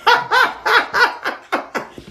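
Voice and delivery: high, long run of laughter. Real, not acted. Card reader laughing off her own tears, high giggling. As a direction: burst into high, repeated giggles.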